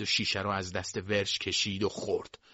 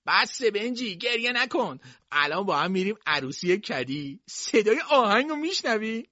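tipsy coaxing; 11 semitones higher, 3 dB louder than usual. A narrator's reading. Drunk Tippy soothing crying Benjy.

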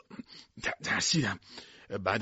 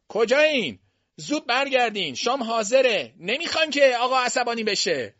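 calling out; 14 semitones higher, 7 dB louder than usual. A narrator's reading. Servant calling the children in to dinner, long high call. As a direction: sing out the dinner call from far off.

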